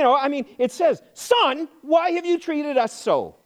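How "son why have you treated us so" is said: high, drawn-out reproach; a little ticked. Retold or imitated. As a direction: read it as an exasperated parent's complaint. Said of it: "They were a little ticked".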